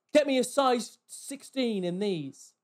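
slow, loud, high customer demand; drawn-out, demanding rudeness; rude demand. Retold or imitated. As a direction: imitate a bossy customer giving orders. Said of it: "Like, really rude".

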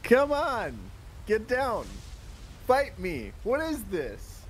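in-character indignant holler; yelled challenge. Acted. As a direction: shout up at an enemy, goading them to fight. Still yelling up at the spellcaster.

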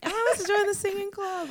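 high-pitched, gleeful crowd clamour; playful squeal of mass enthusiasm; mock excitement. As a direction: exclaim it high like an eager crowd.

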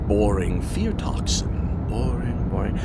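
quiet, slow bored muttering; bored dismissive. Acted. Skimming the files to himself, bored muttering. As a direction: mutter it, unimpressed.

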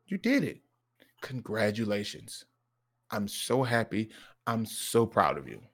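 soft, heartfelt praise; tender pride. Retold or imitated. Dad praising son's grades, soft and tender. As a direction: congratulate softly and sincerely. Proud dad congratulating son.

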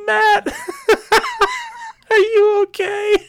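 put-on high, giggling "are you okay" to a hurt kid; laughing while asking. Re-enacting asking injured friend, laughing high-pitched. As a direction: ask if he's okay but can't stop laughing. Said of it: "So, so it sounded just like this".

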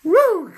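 high, loud joyful whoop; spontaneous cry of praise. Real, not acted. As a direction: let out a high happy whoop. Preacher fired up.

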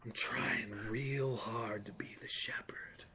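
slow, breathy, dramatic whisper. Retold or imitated. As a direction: whisper it like a movie climax. Continuing the whispered movie quote.

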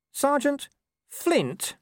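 very high, disbelieving squeak; little voice. A narrator's reading. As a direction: repeat it in a small stunned voice. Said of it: "said Nobby in a little voice".

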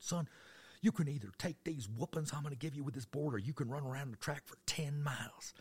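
high-pitched Southern coach laying down punishment; threatening coach. Retold or imitated. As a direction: play a coach handing out an ultimatum.

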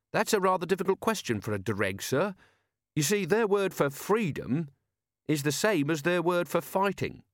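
put-on eager polite young watchman; Carrot's voice.

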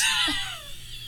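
squealing high laugh, breath pushed out; high squeal of laughter; shrieking laugh. Real, not acted. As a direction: shriek with disbelief at the message. Laughing at the weird message.

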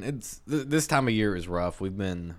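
normal talking voice

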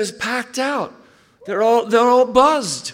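imitating the Glaswegian pastor's soaring excitement about his church; thrilled Barry. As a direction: climb up into a giddy, breathless report. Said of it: "he said".

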